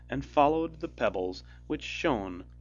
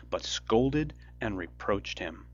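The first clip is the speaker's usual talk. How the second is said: ordinary delivery, nothing marked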